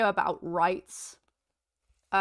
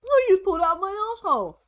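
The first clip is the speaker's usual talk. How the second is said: sarcastic; 9 semitones higher, 5 dB louder, faster than usual. Pretend. Sarcastic mock line.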